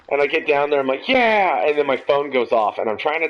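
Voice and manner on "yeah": put-on high, elated drunken whoop; excited cheer. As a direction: cheer like you just won the race. Drunken triumph after sprinting down.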